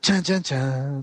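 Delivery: slow, sung-out mock suspense sting; dramatic sting imitation. Storyteller cues the big turning point, mock dramatic sting. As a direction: imitate a drawn-out dramatic music cue. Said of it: "cue dramatic squirrel".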